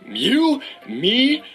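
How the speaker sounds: high, hyped-up buddy pitch; Mark Wahlberg. Retold or imitated. Movie star declaring a fun outing, hyped. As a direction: declare the plan in punchy pieces.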